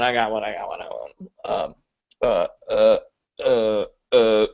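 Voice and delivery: ordinary talk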